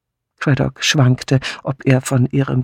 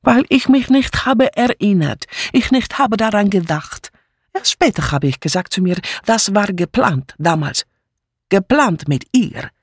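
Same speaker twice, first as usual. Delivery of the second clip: put-on higher, insistent accented German; broken German, indignant refugee maid; defensive foreign maid; 6 semitones higher than usual. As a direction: defend yourself with agitated emphasis. Mitzi defends herself.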